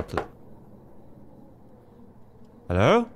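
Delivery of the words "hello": high, loud questioning call; wary calling out. Real, not acted. Player to an unseen presence mid-sentence, calling out warily. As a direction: call out sharply rising to whoever might be there.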